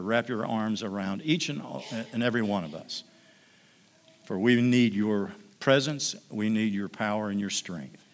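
soft, earnest pastoral prayer. Real, not acted. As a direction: pray gently for the mothers. Leads congregation in prayer.

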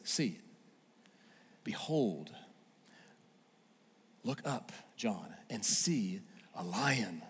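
soft, slow comforting words; gentle elder. Retold or imitated. Elder comforts weeping John, soft and slow. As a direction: coax him to lift his head. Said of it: "one of the elders walks over and says".

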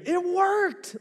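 high, loud retold victory scream; scream of triumph. Pastor reliving flipping the breaker, screaming in relief. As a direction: yell it like you survived the breaker. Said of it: "and I scream".

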